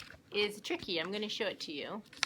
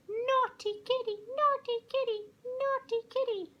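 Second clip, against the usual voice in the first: much higher, louder bird voice; scolding birds; 3 dB louder than usual. Acted. Birds look down and scold the hungry kitty, high and chirpy. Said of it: "The birds look down at Kitty".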